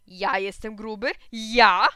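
slow disbelief bursting into a very loud last word; indignant shout. A narrator's reading. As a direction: shout back as an offended neighbour. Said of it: "krzyknął pan Bledur". (oburzony okrzyk sąsiada)

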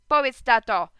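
loud call down the stairs; shout. Acted. Boy runs down and shouts to his father, loud. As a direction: call out to dad as he arrives. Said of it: "krzyknąłem".

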